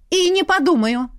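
high-pitched, cheeky refusal; defiant retort. A narrator's reading. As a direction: fire it back defiantly. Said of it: "дерзко откликнулась мама". (дерзко, высоким голосом)

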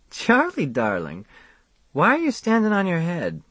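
Faye's voice, playful; lightly voiced Faye. A narrator's reading. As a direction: tease him lightly as Faye.

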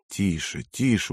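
soft, hushed calming of a horse; quiet, soothing murmur. A narrator's reading. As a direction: settle a nervous horse gently, low and soft. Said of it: "успокаивал он лошадь".

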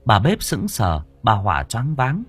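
plain reading voice

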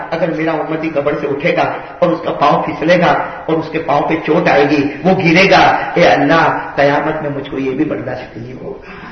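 ordinary delivery

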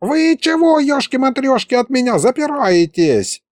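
put-on high, chatty old wizard voice; an excitable old sorcerer prattling on. Wizard bursts in. (высокий голос болтливого старого колдуна)

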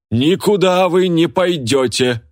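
high, drawn-out forceful holler; barked command. A narrator's reading. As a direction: bellow the ban slowly, brooking no argument. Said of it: "гаркнула Лика". (властный протяжный окрик)

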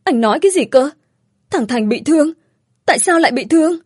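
mildly voiced panic, pitch lifted; trembling. A narrator's reading. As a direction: ask in rising shock at bad news. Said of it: "vừa run run nói".

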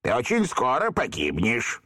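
медленно, злорадно; с мерзкой ухмылкой; smug satisfaction. A narrator's reading. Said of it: "с удовлетворением констатировал". (с удовлетворением)

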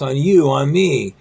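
usual speaking voice

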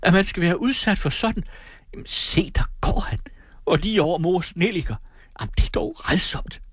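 put-on high-pitched, fussing young woman; indignant Amalie. A narrator's reading. Amalie watches Brandt cross mother's carnations, high-pitched dismay. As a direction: exclaim in dismay, pitched well up. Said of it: "råbte Amalie".

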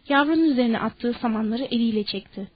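ordinary delivery, nothing marked

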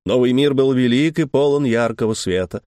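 plain reading voice

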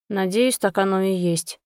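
usual speaking voice

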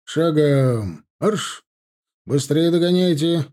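loud officer's order; barked command. A narrator's reading. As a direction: bark the marching order. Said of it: "скомандовал основному отряду поручик".

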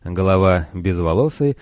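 plain reading voice

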